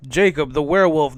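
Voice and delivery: ordinary talk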